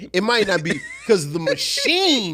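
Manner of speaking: loud, high, slow hammed-up outrage; mock conspiracy rant. Telling co-host the album is suppressed, mock outraged rant. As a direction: stretch it out loudly in mock indignation.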